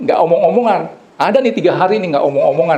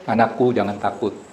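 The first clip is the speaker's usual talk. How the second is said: lower, softer, calm voice from above; gentle voice of God; 5 semitones lower, 5 dB quieter than usual. Retold or imitated. God's voice reassures the hanging pastor, low and soft. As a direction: speak like a soothing heavenly voice. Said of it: "Tiba-tiba ada suara".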